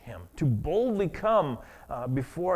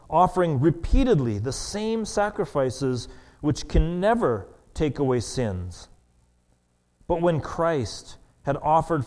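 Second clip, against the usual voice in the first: louder, measured reading voice; scripture reading; 4 dB louder than usual. Acted. Preacher reading Hebrews ten to the congregation, proclaiming. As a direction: proclaim the text to the church.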